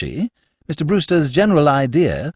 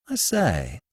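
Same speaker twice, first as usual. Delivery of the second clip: dismay; 6 dB quieter than usual. A narrator's reading. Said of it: "he observed with dismay".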